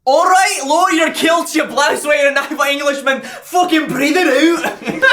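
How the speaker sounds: put-on high, loud shouted Scottish accent; Scottish coach yelling at the listener; Gregor Scottish accent. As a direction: bark it as a furious Scottish meditation coach. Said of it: "How would Gregor say it?".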